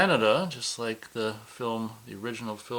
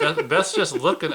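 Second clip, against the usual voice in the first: laughing; 6 semitones higher, 7 dB louder than usual. Real, not acted. Laughing at cohost's silent stare.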